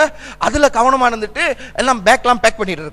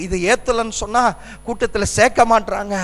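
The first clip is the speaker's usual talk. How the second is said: usual speaking voice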